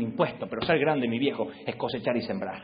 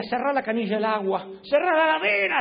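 high, louder mock scolding father; imitating dad; 11 semitones higher, 4 dB louder than usual. Father nagging the son about water and fridge, high and loud. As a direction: nag like an exasperated dad. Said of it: "hacía mi papá".

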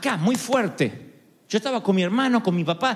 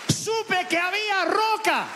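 slower, higher echo; 12 semitones higher, slower than usual. Real, not acted. Preacher echoing his revelation to the congregation. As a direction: repeat it slow and high, in awe. Repeated climax line.

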